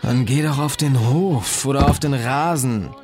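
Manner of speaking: irritated, pitch lifted; exasperated suggestion. A narrator's reading. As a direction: tell him to take it elsewhere, annoyed. Sleeper argues back at roommate.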